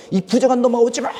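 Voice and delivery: high, loud hostile yell; angry shout. Retold or imitated. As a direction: drive the unclean one away. Villagers drive the woman away. (부정한 사람을 쫓아내며 화내는 외침)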